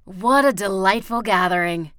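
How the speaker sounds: loud, hammed-up delight; big showman's greeting with a touch of mirth; amused. A narrator's reading. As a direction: boom it out as if highly entertained. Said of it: "in a voice full of laughter".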